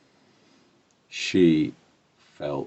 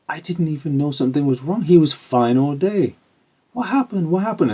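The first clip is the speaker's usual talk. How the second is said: high, baffled, concerned voice; surprised aunt; 11 semitones higher, 6 dB louder than usual. Retold or imitated. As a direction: imitate an aunt caught off guard, pitch raised throughout. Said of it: "was surprised. She said".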